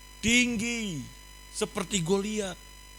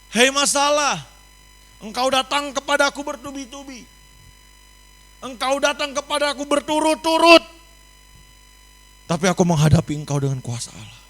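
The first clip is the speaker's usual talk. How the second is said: loud, high, bold challenge to trouble; defiant declaration; 9 semitones higher, 10 dB louder than usual. Pretend. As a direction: shout it down like a fighter. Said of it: "Bisa nggak kita katakan seperti ini". (seruan lantang menantang masalah)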